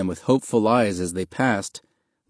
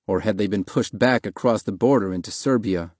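usual speaking voice

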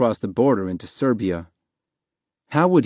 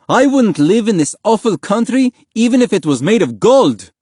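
loud, high-pitched angry declaration; furious outburst; 11 semitones higher, 7 dB louder than usual. A narrator's reading. Father slamming table, refusing asylum, furious outburst. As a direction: throw your refusal at him in fury. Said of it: "slammed his hand flat on the table".

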